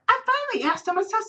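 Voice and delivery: ordinary talk, nothing marked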